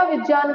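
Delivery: "विज्ञान" said as vij-yaan; Sanskrit pronunciation. Shown as a demonstration. Teacher answering her own question, "विज्ञान" as vij-yaan. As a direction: put j plus y in "विज्ञान", no g. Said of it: "तो ये क्या कहलाएगा".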